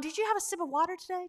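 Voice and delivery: mock motivational speaker. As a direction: lightly parody an eager audience check-in. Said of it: "she's gonna ask things that".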